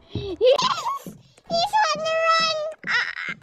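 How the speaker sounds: very high squeal with laughter; giddy high-pitched glee; gleeful excitement. Real, not acted. Delight as target flees.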